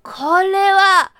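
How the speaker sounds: put-on disgusted old man. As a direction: spit it out in shock. Grandpa spits out the drink.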